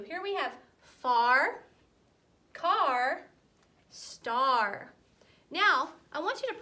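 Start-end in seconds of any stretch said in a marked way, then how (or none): 0.0-4.9 "far", "car", "star" said slowly and loudly, stretching the R; slow, loud classroom demo; slow word demo. Shown as a demonstration. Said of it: "let's practice a few".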